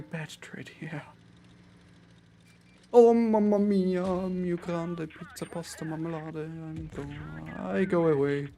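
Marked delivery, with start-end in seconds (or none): 2.9-8.5 put-on Italian accent. Mock Italian outburst after bad trade.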